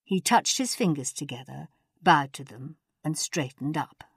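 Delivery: ordinary delivery, nothing marked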